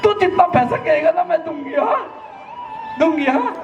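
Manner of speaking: mother crying over her beaten son; frantic mother. Acted. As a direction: fuss and sob over the hurt child. Mother begs son not to leave.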